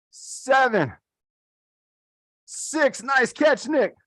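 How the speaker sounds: high, eager count down to the buzzer; excited countdown. Real, not acted. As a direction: call the numbers up high like a timer. Counting down a timed catch drill.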